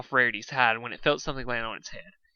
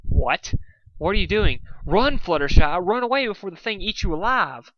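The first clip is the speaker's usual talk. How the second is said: alarmed shouting; 7 semitones higher, 5 dB louder than usual. A narrator's reading. Warning friend about monster.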